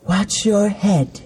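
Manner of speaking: high, slow android voice; breathy starlet robot. A narrator's reading. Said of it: "says the Darcy Android five thousand series".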